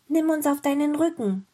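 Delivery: lightly voiced pleading girl; little girl pleading. A narrator's reading. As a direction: ask it as a little girl calling to an animal. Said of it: "bat das kleine Mädchen".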